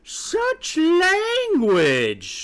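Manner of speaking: very high, drawn-out scandalized squawk. A narrator's reading. Mother hen hears rude words, squawking. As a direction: stretch it out in shrieky outrage. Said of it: "squawked Sapphira".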